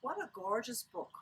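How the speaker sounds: plain reading voice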